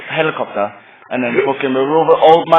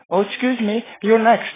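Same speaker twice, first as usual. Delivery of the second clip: polite teller; 7 semitones higher than usual. Retold or imitated. Bank teller calling the raver forward, lightly prim. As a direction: play a sweet polite clerk, lightly.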